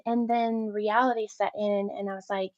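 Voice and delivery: ordinary talk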